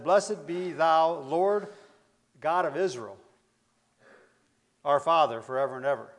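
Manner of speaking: solemn recitation. Retold or imitated. Reciting David's blessing before congregation.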